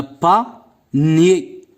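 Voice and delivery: "pas nié" as two separate beats, pa, pause, nié; split into syllables. Shown as a demonstration. Teacher showing pupils two syllables, word split in two. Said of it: "Deux syllabes".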